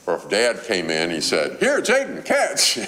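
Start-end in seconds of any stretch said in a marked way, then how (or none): 1.6-2.8 high, bright call to toss the ball; playful dad. Retold or imitated.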